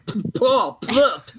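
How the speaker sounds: mock lisping sputter on the P's, spraying each "p-"; Bob Murray lisp. Referee retelling a coach's lisp, mock sputter. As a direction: spit out the "P-, Paul" like a coach with a lisp. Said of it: "who had a lisp".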